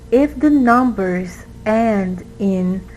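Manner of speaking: usual speaking voice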